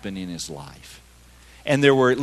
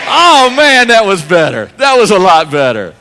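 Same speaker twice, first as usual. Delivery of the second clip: excited praise; 5 semitones higher, 12 dB louder than usual. Real, not acted. Pastor praising crowd response.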